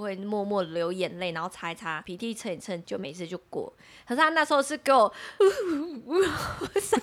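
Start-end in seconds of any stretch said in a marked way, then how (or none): 5.1-6.7 long laugh trailing down. Real, not acted. Recalling the friend's wild sobbing, a long falling laugh.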